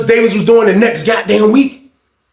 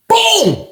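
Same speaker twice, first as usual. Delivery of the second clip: high-pitched falling boom; imitated knockout boom. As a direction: mimic one big punch landing, pitched way up.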